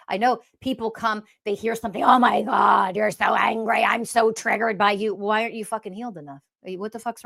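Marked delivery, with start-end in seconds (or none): 2.0-5.1 mock offended follower.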